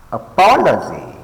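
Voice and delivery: a-POL-ogy, punching POL; stress on second syllable. Shown as a demonstration. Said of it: "pay attention to the stress syllables".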